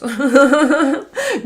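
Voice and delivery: bright laugh. Real, not acted. Laughing at her own advice.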